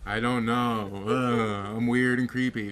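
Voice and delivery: put-on slow, dragged-out drunken slur; drunk Johnny Depp. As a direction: play him drunk and lost, words stretched out. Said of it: "You're just like".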